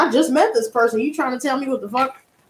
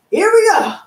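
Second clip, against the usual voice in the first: excited; 7 semitones higher, 5 dB louder than usual. Real, not acted. Excited build-up before unboxing.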